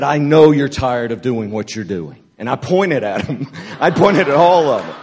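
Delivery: retold fiery prophetic word; prophetic declaration. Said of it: "Prophetic anointing came on me".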